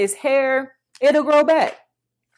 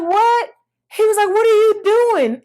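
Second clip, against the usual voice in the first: much higher, louder shocked what; shocked reaction; 11 semitones higher, 6 dB louder than usual. Retold or imitated. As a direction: react in disbelief to the haircut photo. Boyfriend reacts to haircut photo.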